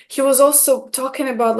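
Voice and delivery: ordinary talk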